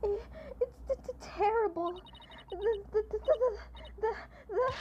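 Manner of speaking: high, panicked stuttering; frightened stammer. Acted. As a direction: stammer it out in terror.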